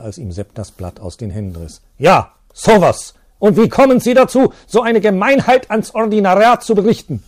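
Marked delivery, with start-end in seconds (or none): 2.0-7.2 furious accusation. Acted. Priest confronting the teacher, furious shouting. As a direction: spit out the accusation in fury.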